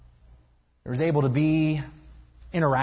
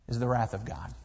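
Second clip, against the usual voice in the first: hushed solemn; 7 semitones lower, 8 dB quieter than usual. Acted. Solemn aftermath of reading.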